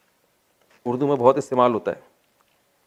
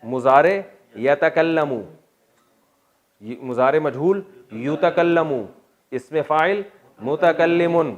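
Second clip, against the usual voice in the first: slow recitation; 3 semitones higher, 3 dB louder, slower than usual. Shown as a demonstration. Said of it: "reciting verb paradigm".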